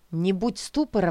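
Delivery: ordinary delivery, nothing marked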